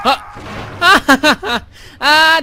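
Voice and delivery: loud gasp, scream, then laugh; startled shriek then laugh; scream into giggles. Real, not acted. Jump scare, then laughs.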